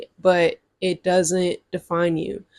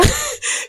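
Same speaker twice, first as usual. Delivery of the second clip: laugh. Real, not acted. Teasing the viewer.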